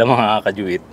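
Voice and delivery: ordinary delivery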